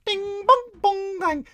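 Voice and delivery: very high sing-song mock chiming; mock Chinese chime sounds. Comic mocking Chinatown, high sing-song chiming. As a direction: squeak out a mocking ding-dong chime. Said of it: "And you go".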